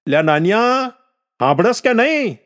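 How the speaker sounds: high-pitched, insistent holler; calling out. A narrator's reading. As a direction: demand an answer from the unresponsive boy. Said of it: "જવાબ ન મળતા ફરીથી બૂમ મારી". (જવાબ માટે ઊંચા સૂરે બૂમ)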